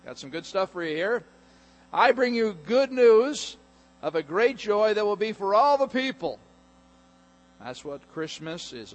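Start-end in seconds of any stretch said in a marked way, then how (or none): 0.0-1.2 reassuring angel. Pretend. Angel calms the terrified shepherds. 1.9-6.4 proclaiming angel. Acted. Angel's message repeated, proclaimed.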